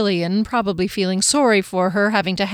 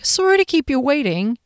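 cheery sister greeting; 6 semitones higher than usual. Acted. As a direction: greet your sister, sing it out high. Jillian apologizes breathlessly.

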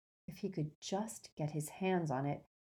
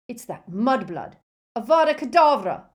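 loud snarl building to high scream; shouted curse; 6 semitones higher, 11 dB louder than usual. Acted. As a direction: hurl a killing curse in fury. Crabbe attacks with a killing curse.

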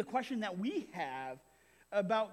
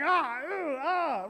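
higher, louder exasperated groans; frustrated father; 6 semitones higher, 6 dB louder, much slower than usual. Retold or imitated. Father fed up with his son, exasperated groaning. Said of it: "as frustrated".

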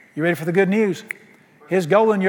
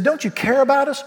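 panicked plea; 8 semitones higher than usual. Acted. Disciples' panicked plea voiced.

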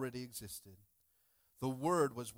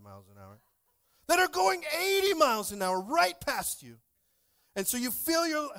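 louder and higher, building up to a near-shout; excited storytelling; 7 semitones higher, 10 dB louder than usual. Retold or imitated. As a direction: get worked up as the trucks fly by. Excited account of speeding trucks.

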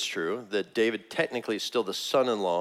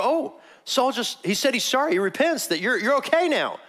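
much higher, gushing good news; naive reassurance; 13 semitones higher, 4 dB louder than usual. Retold or imitated. Onlooker telling David that Saul repents, high eager reassurance. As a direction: bubble it up high, imitating an eager believer. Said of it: "you would pause David right now and go".

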